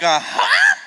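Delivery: a high, sassy, laughing Uh, huh?; huh with attitude. Shown as a demonstration. Preacher demoing a sassy huh for the congregation to copy. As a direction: throw some sass on Uh, huh?. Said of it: "with a little more attitude".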